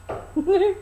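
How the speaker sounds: a little laugh at her own joke; short real laugh. Real, not acted. Reader laughing at her moral for the ladies, chuckle.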